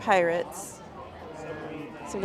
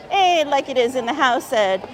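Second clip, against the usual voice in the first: normal talking voice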